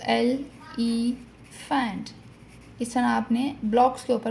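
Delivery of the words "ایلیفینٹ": syllables spelled out slowly. Shown as a demonstration. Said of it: "اگر ہم ایلیفینٹ کی ہی example لیں".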